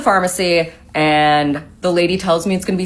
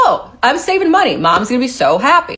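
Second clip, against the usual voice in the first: high, bright delight at saving money; pleased son imitating his own excitement at the counter; excited; 7 semitones higher than usual. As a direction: chirp it like great news. Self quoted, pleased at savings.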